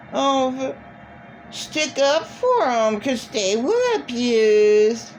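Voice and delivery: put-on high pleading voice, dripping with derision; mocking sympathy. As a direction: parrot a feeble excuse with scorn. Mocks excuses made for abusers.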